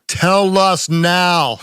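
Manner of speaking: loud, slow, drawn-out demand from eager listeners; impatient listener. Retold or imitated. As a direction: stretch it out like a listener who can't wait. Impatient listeners voiced by host.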